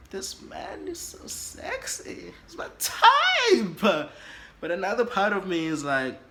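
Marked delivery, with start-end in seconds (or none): 0.1-2.3 slow, airy high swoon. Acted. Part of me admiring the man, swooning. Acts out her swooning side. 2.5-4.1 very loud, high drawn-out squeal; smitten squeal. Acted. Part of me falling for the man, smitten squeal. Acts out her smitten side.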